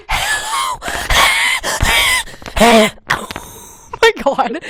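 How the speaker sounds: high squealing shout of hello; mock-horrified squeal; shrieking hello. Real, not acted. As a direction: shriek it out in disbelief. Squealing at cringe fanfic line.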